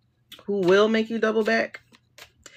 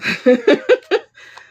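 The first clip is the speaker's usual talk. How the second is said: high loud laugh; 6 semitones higher, 9 dB louder than usual. Real, not acted. Reader laughing at the card's blunt message.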